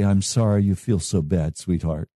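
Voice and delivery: low, soothing acted reassurance; tender comforting. Preacher voicing an adult holding a screaming child, tender comforting.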